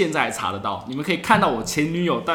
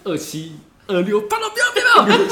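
much higher and louder, near a mock shriek; retold frantic begging; 4 dB louder than usual. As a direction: shriek the pleas as the countdown runs. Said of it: "我一直传说". (尖声重演拼命哀求)

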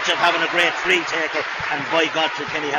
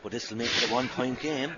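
hushed, much softer tense aside; 4 semitones lower, 6 dB quieter than usual. Real, not acted. Commentator to co-commentator before the free, hushed. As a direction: drop right down as the free is taken. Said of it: "I don't know why I'm whispering".

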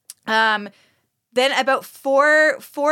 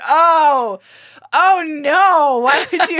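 shocked outcry; 6 semitones higher, 7 dB louder, much slower than usual. Real, not acted. Shocked reaction to cohost's joke.

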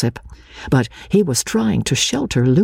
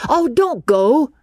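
cried out; 12 semitones higher, slower than usual. A narrator's reading. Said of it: "he cried".

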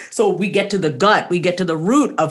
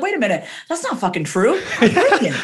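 laughing it off mid-sentence; laughing realization; 2 semitones higher than usual. Retold or imitated. Realizing her fear is fake, laughing through the words. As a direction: catch yourself and laugh at the lie. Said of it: "you're like".

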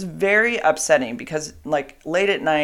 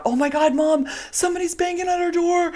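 much higher, panicky imitation of teen girls; imitating panicked daughters. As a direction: mimic the girls freaking out. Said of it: "they're freaking out".

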